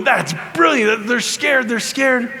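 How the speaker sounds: high, delighted crowing; gleeful excitement. Retold or imitated. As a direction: crow over the successful prank, pitched high. Acted glee at apostles' fright.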